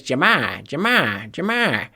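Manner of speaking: put-on Ja'Marr with a Boston accent, r dropped, rising. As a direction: keep repeating Ja'Marr like a Boston reporter, jeh-MAH. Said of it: "super thick Boston accent".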